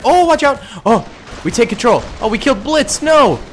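high, loud panicked exclamations; frantic high-pitched gamer alarm; alarmed cry. Real, not acted. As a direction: yell a warning, then cry out at the mishap. Startled warning, teammate killed.

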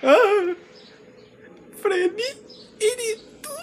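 high, loud frightened shout; panicked high cry; scared high voice. Acted. Scared in dark.

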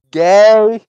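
sudden loud shout of one word. Retold or imitated. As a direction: blurt it out at full volume. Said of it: "and I just shouted".

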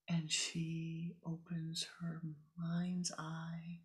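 slow, hushed guided-story whisper. A narrator's reading. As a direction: breathe the scene out in a whisper. Whispered meditation story narration.

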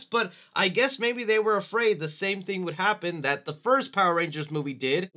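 usual speaking voice

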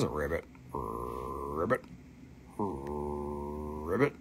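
very low soft ribbit croaks; frog croak. Pretend. Seller voicing the emerald frog, low soft croaks. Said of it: "frog voice on emerald frog".

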